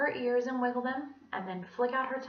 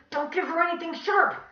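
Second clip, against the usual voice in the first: alarmed warning; 8 semitones higher, 7 dB louder than usual. A narrator's reading. Student warning.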